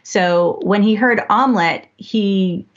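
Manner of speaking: ordinary talk, nothing marked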